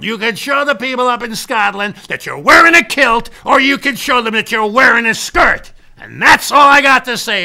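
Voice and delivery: loud, high-pitched fatherly tirade; shouting dad giving his son two choices; angry ultimatum. Retold or imitated. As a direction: lay down the law at full volume. Acted father's ultimatum by phone.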